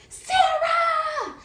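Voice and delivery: very high, stretched-out shriek; long wailing mock call; screaming for help. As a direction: holler desperately for a friend, acted. Pinned, screaming for friend.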